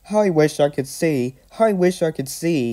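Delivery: high-pitched, plaintive complaint; whining, repeated wish; complaining. A narrator's reading. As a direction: wail it as a little engine in distress. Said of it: "he complained".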